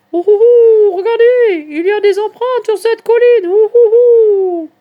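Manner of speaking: very high, loud hooting owl call; Charlotte. A narrator's reading. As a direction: hoot from the sky to friends below. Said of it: "Charlotte les appelle depuis le ciel". (appel aigu de chouette qui ulule)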